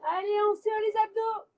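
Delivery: rally the class to squeeze; loud coaching call. Real, not acted. Coach cheering during abs hold.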